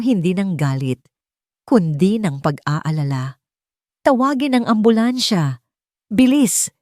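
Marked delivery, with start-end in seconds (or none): 4.0-6.7 alarmed shout. A narrator's reading. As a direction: yell for the ambulance in worry. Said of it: "isang sigaw ang kumawala". (sigaw ng pag-aalala, hindi galit)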